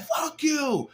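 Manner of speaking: high yelled curse, retold; mock shout. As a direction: bark it back like the drunk guy did. Rider curses narrator.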